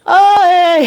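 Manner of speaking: high, loud sing-song greeting; high-pitched hammed-up hey; Color Purple impression. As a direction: mimic a Color Purple hello. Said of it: "He basically doing the beat from Damn Color Purple".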